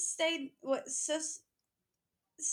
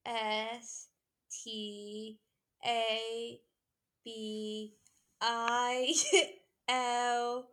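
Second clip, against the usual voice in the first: slow letter-by-letter spelling voice; 3 dB louder, much slower than usual. Pretend. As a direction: spell each letter out slowly. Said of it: "In the spelling voice".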